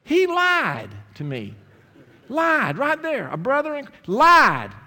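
loud, high, slow mock outrage at the lie; indignant outburst. Preacher tells the congregation his doctor lied, loud mock-indignant. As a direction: nearly shout that he lied, stretching it out.

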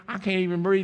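normal talking voice

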